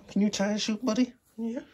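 squeaky high voice to the pet. Real, not acted. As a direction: coo the question up high.

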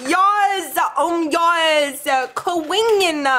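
loud, high victory yell; triumphant shout. Real, not acted. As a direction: shout like you just won. Celebrating a bite.

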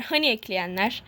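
ordinary talk

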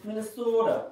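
lightly put-on Minnesota accent; "Minnesota" sung high, Minnesota accent. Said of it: "My, my Minnesota accent".

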